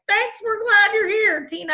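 mock sweet old-timer. Old-timers thanking their greeter Tina, high mock-sweet voice. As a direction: squeak it out like a frail old-timer.

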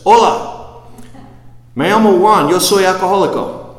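high, loud Spanish greeting; Spanish speaker. Retold or imitated. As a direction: call out cheerfully in Spanish. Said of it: "Some guy says".

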